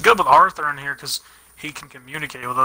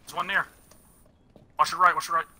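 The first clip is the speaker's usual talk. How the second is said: ordinary talk, nothing marked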